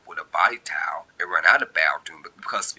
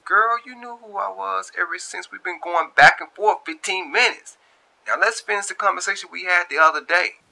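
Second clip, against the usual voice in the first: high, loud teasing male voice; Darius teasing; 12 semitones higher, 6 dB louder than usual. A narrator's reading. Darius teasing Shantae that she knew him, high and loud. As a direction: tease her, pitched up. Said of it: "Darius in his mind saying".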